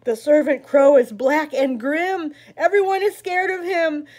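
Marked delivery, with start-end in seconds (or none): none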